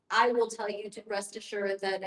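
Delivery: normal talking voice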